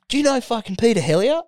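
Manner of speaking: mocking an eager fan; imitating a bro. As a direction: rattle it off in a high bro voice.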